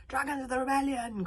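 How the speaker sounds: ringing commander's call. A narrator's reading. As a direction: summon an army of dragons. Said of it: "called the mighty East".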